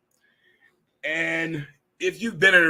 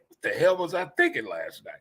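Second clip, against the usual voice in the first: ordinary delivery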